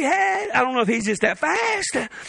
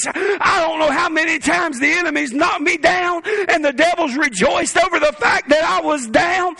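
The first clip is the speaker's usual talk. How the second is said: preaching at full force; 4 semitones higher than usual. Acted. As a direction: preach it out to the congregation.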